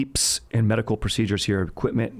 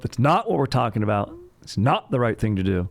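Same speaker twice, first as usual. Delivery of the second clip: ordinary talk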